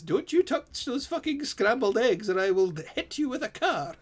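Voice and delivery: high-pitched mock threat; threatening look voiced. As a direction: voice a comically menacing glare, pitched high. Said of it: "a look that says".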